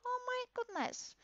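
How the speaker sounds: high startled exclamation; real surprise at the avatar's voice; surprised. Real, not acted. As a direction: gasp it out, caught off guard. Reacting to the avatar voice.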